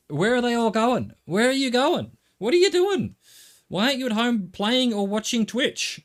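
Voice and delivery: loud, high-pitched mock outrage; exasperated rant. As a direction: shout questions at all the other drivers. Mock rant at all the drivers.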